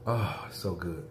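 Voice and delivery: savoring after a slurp; sipping hot tea. Real, not acted. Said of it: "Sorry for slurping".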